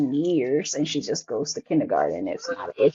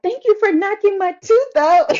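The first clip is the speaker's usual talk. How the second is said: put-on high, loud happy kid voice; cheerful daughter; 5 dB louder than usual. Daughter forgiving her brother, high and bright. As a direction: chirp it like a kid excited about money. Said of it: "she's like".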